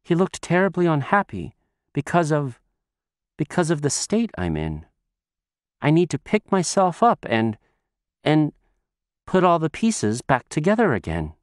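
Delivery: tearful. Acted. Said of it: "with tears in her eyes".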